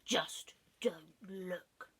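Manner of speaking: drawn-out secretive whisper; slow fox whisper. Acted. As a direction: breathe it slowly like a sly fox. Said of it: "said the fox".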